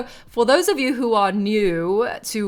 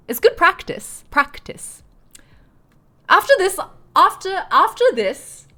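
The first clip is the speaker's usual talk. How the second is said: higher, louder stab at a posh British voice; posh British accent; 11 semitones higher, 6 dB louder than usual. Shown as a demonstration. Streamer practising her posh British accent, a bit higher and louder. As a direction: reach for posh English vowels. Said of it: "I've lost the posh Brit".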